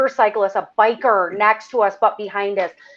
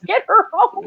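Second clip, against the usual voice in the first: mild mock yell; 9 semitones higher than usual. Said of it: "He yelled to my friends".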